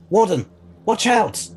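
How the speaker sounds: shouting a warning to the warden as something attacks; urgent warning shout. Acted. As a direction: yell a warning to save him. Sudden danger in the basement.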